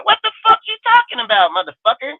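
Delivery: incredulous outburst. Real, not acted. Streamer demands what a critic is talking about, incredulous. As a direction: fire it back in disbelief.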